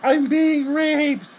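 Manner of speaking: very high, loud, drawn-out mock wail; mock screamed cry. Reviewer imitates the film's victim, exaggerated high scream. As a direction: yell it slowly in a hammed-up high voice. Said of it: "of course she's like".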